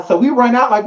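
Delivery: ordinary talk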